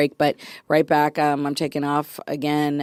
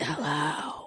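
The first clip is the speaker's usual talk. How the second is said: spooky hushed hello; 4 semitones lower, 13 dB quieter than usual. Acted. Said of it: "you sound all extra, like, scary".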